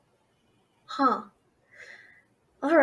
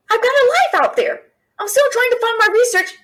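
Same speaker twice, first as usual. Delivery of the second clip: indignant protest; 7 semitones higher, 3 dB louder than usual. Real, not acted. Refusing to be a housekeeper.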